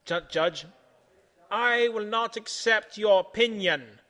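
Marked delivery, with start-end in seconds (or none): 1.5-4.0 loud, slow, emphatic refusal; yelled defiance. A narrator's reading. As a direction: yell it slowly, word by word. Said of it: "yells Centipede".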